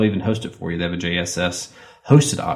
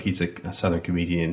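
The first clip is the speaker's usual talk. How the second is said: ordinary talk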